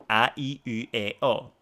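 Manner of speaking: A, i, u, e, o as distinct pure vowels; Japanese vowel sounds. Shown as a demonstration. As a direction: list A, i, u, e, o evenly and low. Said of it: "There's no ah sound".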